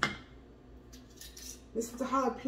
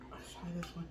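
whispering; 5 semitones lower, 14 dB quieter, faster than usual. Real, not acted. Whispers before tasting.

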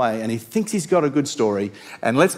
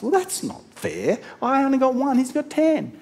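whiny complaint; 10 semitones higher than usual. Retold or imitated. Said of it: "servant complains".

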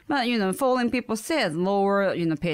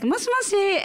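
much higher polite phone greeting; 12 semitones higher than usual. Retold or imitated. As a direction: lift it up sweet and polite. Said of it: "So I was like".

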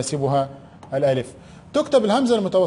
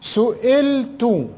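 سئلت drawn out slowly; slow dictation; 5 semitones higher, much slower than usual. Shown as a demonstration. Teacher to pupils, slow drawn-out سئلت. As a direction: stretch سئلت syllable by syllable. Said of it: "teacher dictating word slowly".

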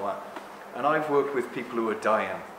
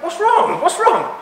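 alarmed; 9 dB louder, slower than usual. Retold or imitated. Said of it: "I went".

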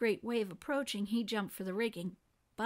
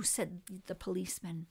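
usual speaking voice

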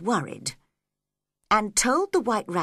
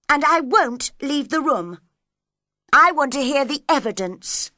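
raised, loud refusal; defiant; 5 semitones higher, 6 dB louder than usual. Acted. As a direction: stand your ground against the queen. Alice defies the Queen.

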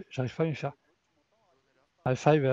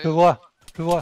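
urgent, louder shout of a sighting; excited callout; 3 semitones higher, 11 dB louder than usual. Real, not acted. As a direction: call it out fast to the team. Spots enemy in firefight, calls out.

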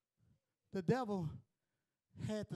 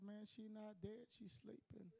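much softer, almost under the breath; hushed retelling; 14 dB quieter than usual. Retold or imitated. Preacher quietly retelling Jesus's words, hushed. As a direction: drop it right down, hushed. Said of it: "He's like".

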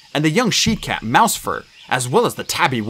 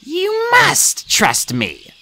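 drawn-out, high-pitched growl; much slower than usual. A narrator's reading. Yellowfang demands trust from the two apprentices, slow high growl. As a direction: growl it very slowly, stretching each word. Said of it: "growled Yellowfang".

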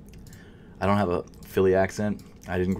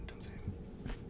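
dreading whisper; 22 dB quieter, much slower than usual. Real, not acted. Dreading error on checkout page.

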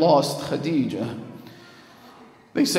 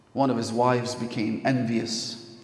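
ordinary talk

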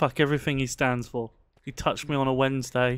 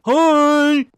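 excited greeting; 12 semitones higher, 11 dB louder than usual. Real, not acted. Greeting a watcher on stream.

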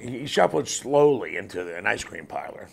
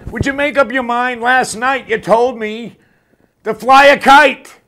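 high mock shout building louder to the end; loud, exasperated joke yell; yelling back; 8 semitones higher, 9 dB louder than usual. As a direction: holler it, stretching out the punchline. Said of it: "the guy yells out".

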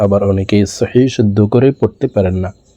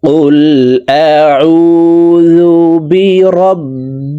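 high, very slow melodic chant; chanted Quranic verse, raised pitch; Quran recitation; 7 semitones higher, 4 dB louder, much slower than usual. Shown as a demonstration. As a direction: stretch every syllable in recitation.

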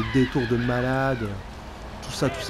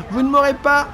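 mock gangster taunt; 8 semitones higher than usual. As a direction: brag at the cops like a cocky crook. Taunting cops as criminal.

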